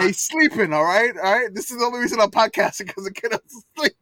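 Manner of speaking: high, loud comic protest; animated protest. Real, not acted. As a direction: push back loudly, pitched well up. Protesting requests to fetch kid.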